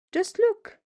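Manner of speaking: high croak in horror; croaking cat. A narrator's reading. Cat Tabitha sees the broom halves, high horrified croak. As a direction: croak it out aghast. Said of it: "she croaked".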